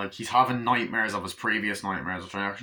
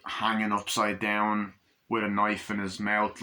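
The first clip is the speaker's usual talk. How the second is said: ordinary delivery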